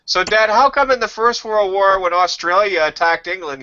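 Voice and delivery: put-on high, earnest child question; kid voice. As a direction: ask like a curious little boy. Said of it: "child asking dad".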